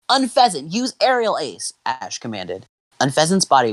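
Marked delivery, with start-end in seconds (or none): 0.1-1.7 high-pitched battle command; commanding trainer. Acted. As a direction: call out the attack with gusto. Ash commands his Pokémon.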